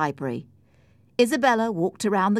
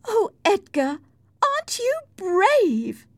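high, loud, drawn-out mockery; mock him with exaggerated admiration; sarcastic cry; 5 dB louder, slower than usual. A narrator's reading. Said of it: "cried Cathy sarcastically".

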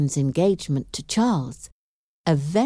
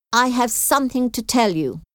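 higher-pitched, with a touch of aggression; mildly aggressive; 7 semitones higher than usual. A narrator's reading. Old lady confronting Elizabeth at her house, mildly aggressive. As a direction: confront her, but keep it restrained. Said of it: "she said in an aggressive voice".

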